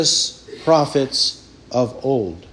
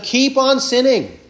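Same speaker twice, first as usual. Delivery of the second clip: mock command; 7 semitones higher than usual. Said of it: "He's telling them".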